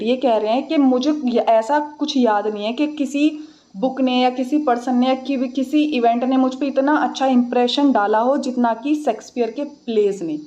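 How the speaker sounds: ordinary delivery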